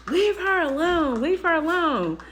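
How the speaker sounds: mock a bratty kid chanting; taunting kid. Little girl taunting the daughter, slow singsong mockery. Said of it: "started talking crazy to my daughter".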